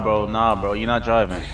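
normal talking voice

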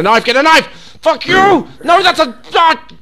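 rising into a high, loud squeal; panicked yell. Real, not acted. Player attacked while grabbing a knife, panicked yelling. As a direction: build from urgent to shrieking.

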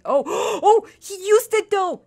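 high gasp of delight; gasp, then squeal the good news; excited and breath-catching; thrilled discovery. Real, not acted. Finding the dog used the toy.

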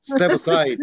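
imitating son telling dad to step aside; impatient kid. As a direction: push the parent out of the way. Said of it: "Like, uh".

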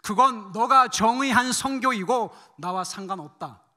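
slow, loud, emphatic dismissal; God cutting in. Retold or imitated. God rejecting the missionary's idea of mission, firm and emphatic. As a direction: pronounce each word firmly and loudly. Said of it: "말이 끝나기도 전에 하나님이 이렇게 말씀하셨습니다".

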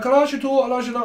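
high, loud French-style questioning; mock French. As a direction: ham up a French teen asking your age. Voicing the French student.

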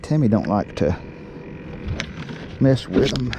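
ordinary talk

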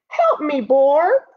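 high cry for help; pleading badger, pitched well up; distressed badger. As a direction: call out for help as a little badger.

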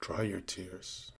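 slow, soft soothing words; comforting. Acted. Man to a crying girl, slow and softly comforting. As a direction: soothe softly, taking your time.

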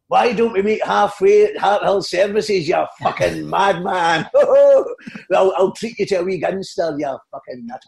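high, drawn-out comic raving; Billy Connolly impression. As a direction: tease a star as a mimicked comedian, stretching the insults. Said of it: "looking for Billy Connolly".

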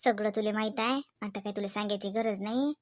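whispered-down temple prayer; hushed prayer. Acted. Prays aloud while filling oti.

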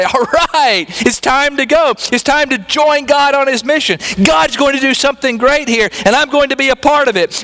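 high, bright eager yes to God; eager Samuel. Pretend. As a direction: jump up ready to go, pitched high. Imagined eager Samuel answering God.

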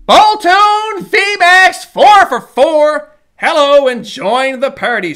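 slow, loud and high with thrill; excited collector greets his pull. Real, not acted. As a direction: draw out the big pull proudly. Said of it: "Voice gets higher, gets more excited".

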